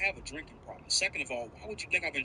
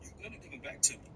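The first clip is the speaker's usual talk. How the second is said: ordinary delivery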